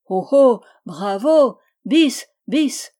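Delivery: loud shouts for an encore; cheering. A narrator's reading. Listeners applaud the flute player, loud cheering.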